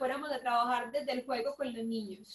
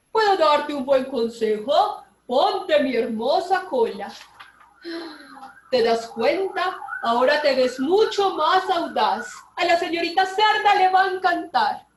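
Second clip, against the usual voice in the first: sly fox; 2 semitones higher, 9 dB louder than usual. A narrator's reading. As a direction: sweet-talk him like a sly fox. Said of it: "dijo el zorro".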